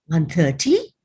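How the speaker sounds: mild, incredulous scolding question. A narrator's reading. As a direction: throw the time back as a reproach. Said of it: "she scolds".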